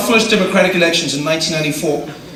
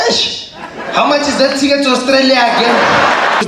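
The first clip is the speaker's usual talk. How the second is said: nervous traveller; 7 semitones higher than usual. Retold or imitated. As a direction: ask about flights in a panic.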